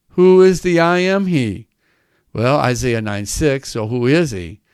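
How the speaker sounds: ordinary delivery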